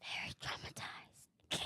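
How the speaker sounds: a mock-ominous whisper. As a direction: breathe it out in a whisper. Teasing echo of classmate.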